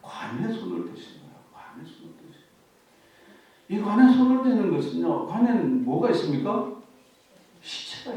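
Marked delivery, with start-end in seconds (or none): 1.5-3.7 "관에 손을 대신 거예요" whispered slowly; hushed slow repeat. Shown as a demonstration. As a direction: let "손을" sink in with a slow whisper. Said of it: "repeated softly".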